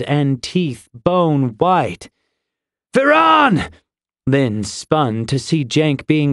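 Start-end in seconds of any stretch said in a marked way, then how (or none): 2.9-3.7 high, drawn-out battle call; urgent shout. A narrator's reading. Fighter calling to Viran amid battle, shouting. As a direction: shout the name high across the fray.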